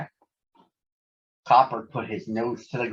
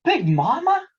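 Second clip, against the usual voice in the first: much higher, delighted disbelief; can't believe it's her; surprised cry; 13 semitones higher than usual. Acted. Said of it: "he cried".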